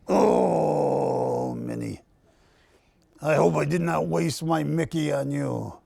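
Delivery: mild mock-hurt mouse voice; mouse accent Mickey. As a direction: sulk a little as Mickey Mouse. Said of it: "somewhat of a mouse accent".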